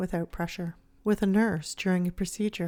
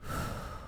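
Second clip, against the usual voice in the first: quiet exhale out through the mouth; soft sigh; 9 dB quieter than usual. Shown as a demonstration. Pausing to breathe before answering a partner, soft sigh. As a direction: let out a slow calming sigh.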